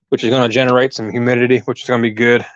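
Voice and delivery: breathless, catching breath between words. Real, not acted. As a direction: talk while still winded. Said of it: "I'm out of breath".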